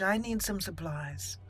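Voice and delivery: ordinary talk, nothing marked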